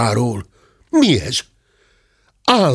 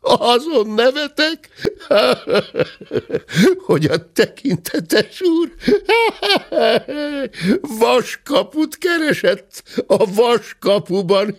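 high words broken by belly laughs; can barely get it out for laughing; laughing while speaking; 14 semitones higher than usual. A narrator's reading. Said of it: "rengett a tokája a nagy nevetéstől". (nevetéstől elcsukló beszéd)

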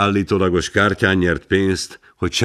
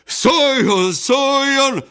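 high chanted shout of the crowd; hundred voices shouting; 12 semitones higher, much slower than usual. A narrator's reading. The townsfolk clamour for Wilson to speak, chanting shout. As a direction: chant his name like a hall full of people. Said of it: "Száz hang".

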